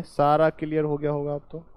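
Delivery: ordinary talk, nothing marked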